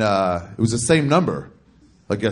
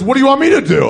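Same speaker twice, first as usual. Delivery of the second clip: much higher, loud mock exasperation; exasperated; 13 semitones higher, 9 dB louder, faster than usual. Hungry customer complaining to the stranger on the phone, exasperated. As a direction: imitate an irritated caller, pitched way up.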